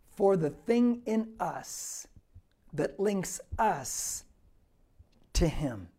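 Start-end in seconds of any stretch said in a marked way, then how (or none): 2.7-5.9 slow, deliberate. Acted. Preacher repeats phrase slowly.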